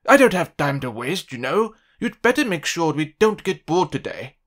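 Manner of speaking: loud and haughty, talking down to the host; a pompous guest making demands loudly; rude demand. Acted. Rude Mister Uppity demands entertainment.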